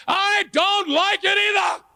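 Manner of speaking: very high, slow comic squeal; exasperated outburst. Acted. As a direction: stretch it into a high-pitched protest. Comic outburst about life's problems.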